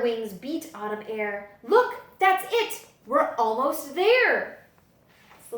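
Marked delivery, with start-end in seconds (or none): 1.6-4.7 high, loud and eager as the bats spot it; eager excitement. A narrator's reading. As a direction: point it out, thrilled. Excited bats see the library.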